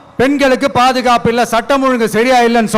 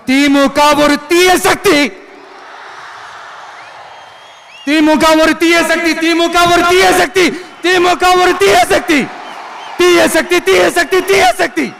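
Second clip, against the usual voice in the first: high, insistent slogan, building in pitch; rally slogan; 6 semitones higher than usual. Real, not acted. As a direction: hammer the slogan out to a crowd. Repeats slogan to rally crowd. (உரத்த குரலில் அரசியல் முழக்கம்)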